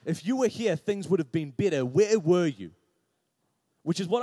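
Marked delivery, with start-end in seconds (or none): 0.0-2.7 louder, rising reproach; confronting Martha. Retold or imitated. Martha confronting Jesus over her brother's death, building reproach. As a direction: build the accusation to a loud final demand. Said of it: "he's confronted by Martha".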